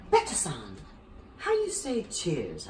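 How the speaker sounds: slow, lightly put-on Japanese accent; imitating Koji slowly; mock Japanese accent. Koji asking how to say cheers, mock Japanese accent. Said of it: "says to me".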